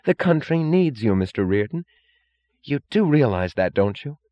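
eager, high-pitched enthusiasm; Lawson. A narrator's reading. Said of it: "with startled enthusiasm".

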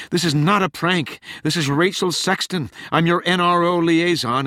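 high, urgent protest; exasperated insistence. A narrator's reading. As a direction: insist you are who you say, pitched high. Exasperated caller insists she is genuine.

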